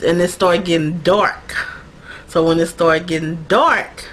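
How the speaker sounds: ordinary talk, nothing marked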